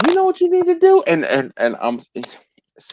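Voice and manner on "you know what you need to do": high, loud friend voice; mock friend giving advice; pushy friend. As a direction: mimic a know-it-all friend. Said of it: "good friends would be like".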